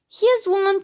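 high-pitched call of discovery; excited cry. A narrator's reading. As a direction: cry out that you spotted it. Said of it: "cried Froggy".

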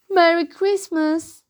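high-pitched holiday call; cheery greeting. A narrator's reading. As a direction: call it out to the parents. Froggy's sheepish greeting to parents.

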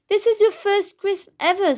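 high, quick exclamation; excited retort. A narrator's reading. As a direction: pipe up in lively protest. Character teases the friends excitedly.

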